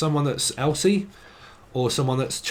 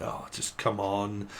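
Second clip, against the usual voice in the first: softer, slow, grudging sigh of words; reluctant mumble; 4 semitones lower, 9 dB quieter, slower than usual. Retold or imitated. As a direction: give in with a soft reluctant mutter. Acting a reluctant reply.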